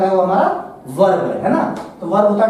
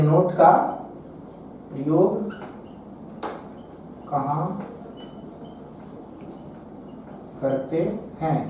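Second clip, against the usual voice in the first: word-by-word dictation; 2 semitones lower, 9 dB quieter, slower than usual. Shown as a demonstration. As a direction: dictate slowly as if writing each word. Same question repeated while writing. (लिखवाते हुए धीरे, रुक-रुककर)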